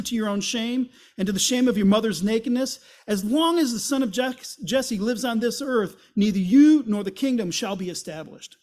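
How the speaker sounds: loud, raging curse at his son; furious Saul. Acted. Saul cursing Jonathan at the feast, furious shout. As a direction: spit out the insult in fury.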